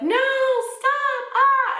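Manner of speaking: very high, loud screamed plea; retold bloody-murder scream. Owner screaming at the attacking dogs, high panicked scream. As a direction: scream it out, reenacting raw panic. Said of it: "I was screaming bloody murder".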